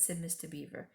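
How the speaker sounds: ordinary delivery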